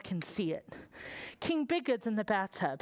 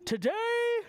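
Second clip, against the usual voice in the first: booming king; slower than usual. Acted. King speaking.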